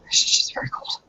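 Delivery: breathy whisper, no voice; whispered aside. Real, not acted. Player whispering to the table about the cold. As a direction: whisper it like a secret. Player describes freezing character.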